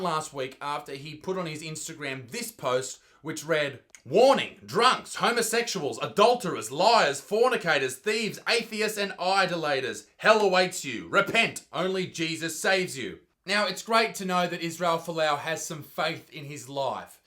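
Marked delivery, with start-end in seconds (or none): 4.0-13.2 loud, emphatic list of sinners; booming mock sermon, each item hit hard; mock preacher warning. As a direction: proclaim it like a doomsday warning. Reads out a fire-and-brimstone post.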